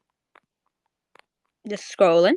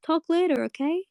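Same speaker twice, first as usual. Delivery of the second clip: Bubblegum voice; 8 semitones higher than usual. Retold or imitated. Bubblegum voiced in retold scene.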